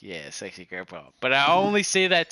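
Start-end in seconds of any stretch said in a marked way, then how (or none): 0.0-1.0 soft teasing. Retold or imitated. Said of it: "And I'm like".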